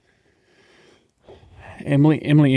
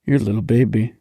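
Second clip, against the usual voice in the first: ordinary delivery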